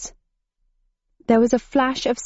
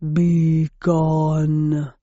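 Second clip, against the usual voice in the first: low breathy Minotaur threat; threatening breath; 3 semitones lower than usual. A narrator's reading. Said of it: "breathed a threatening".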